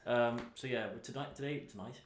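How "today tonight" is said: ordinary talk, nothing marked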